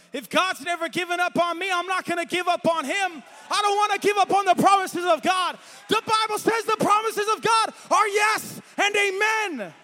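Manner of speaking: rally them to never give up; building shouted preaching. Acted. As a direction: crescendo of pulpit fervor. Preaching at full force.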